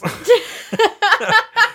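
squealing embarrassed laugh; high burst of laughter. Real, not acted. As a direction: break into giggles. Laughs at own blunder.

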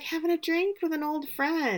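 playful imitation of a flirt; flirty. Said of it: "smiling and flirting with him".

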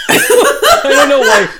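high and loud, laughing through it; slow words through laughter. Real, not acted. Laughing at own voice.

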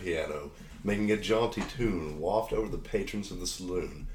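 low, measured scene-setting voice; game master narration. Acted. Game master introduces the saloon town to players, narrating. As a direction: set the stage like a storyteller.

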